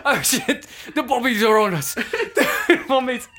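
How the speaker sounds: high, loud alarmed cry; hammed-up panic about the police boat; mock panic. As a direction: sound the alarm, the cops are coming.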